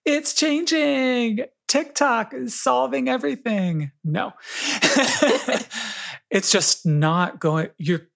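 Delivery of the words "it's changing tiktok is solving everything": high, slow, sing-song fake cheer; sarcastic bright announcement, pitched up and slow; mock optimism. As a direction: sell a too-good-to-be-true promise. Wish I could come on here and say.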